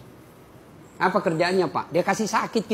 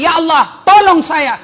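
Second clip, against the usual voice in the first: fervent prayer; 7 semitones higher, 8 dB louder than usual. Acted. Voicing a pleading prayer.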